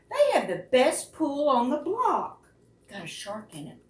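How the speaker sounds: lively picture-book read-aloud with voiced characters; reading aloud to children. A narrator's reading. Librarian reading a whale story to children, animated read-aloud. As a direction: bring the story alive for little listeners.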